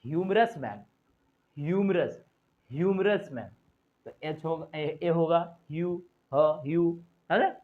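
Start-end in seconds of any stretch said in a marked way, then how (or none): 0.1-3.5 ह्यूमरस with a clear h at the start; h pronounced. Shown as a demonstration. Teacher drills ह्यूमरस मैन for the h sound. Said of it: "sound निकल रहा है एच का".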